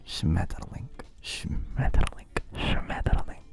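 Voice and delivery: slow whispered German; slow German ASMR whisper. Shown as a demonstration. Playing the master for chat in a whisper. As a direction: breathe each German word out slowly. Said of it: "German sends you tingles".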